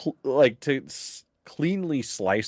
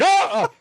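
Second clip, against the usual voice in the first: much higher, laughing mock shriek; mock scream; 6 dB louder than usual. Joking about a botched delivery, high laughing shriek. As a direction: squeal it out between laughs. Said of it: "And it's like".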